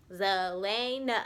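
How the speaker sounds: slow three-beat "Zelena"; "Zelena" measured out syllable by syllable; split into syllables. Shown as a demonstration. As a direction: draw out ze, lay, na in "Zelena". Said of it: "That's three syllables".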